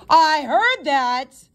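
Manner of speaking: slow, loud booming voice from across the room. A narrator's reading. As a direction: boom it out across the lobby, slowly. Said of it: "a voice boomed from across the room".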